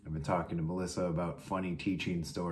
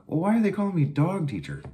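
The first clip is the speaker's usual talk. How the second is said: high, loud, incredulous why are they calling me dog teacher; retold outraged confusion; baffled disbelief; 11 semitones higher, 6 dB louder, faster than usual. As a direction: sound utterly thrown by the news. Retold baffled question to colleague.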